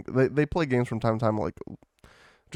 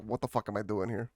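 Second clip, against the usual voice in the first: quiet aside; 10 dB quieter than usual. Pretend. Imagined self at event, aside.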